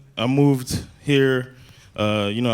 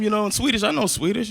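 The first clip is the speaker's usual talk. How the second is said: usual speaking voice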